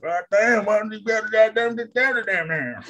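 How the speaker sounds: high whiny complaining voice; mock complainer, high and whiny; griping viewer. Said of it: "They're like".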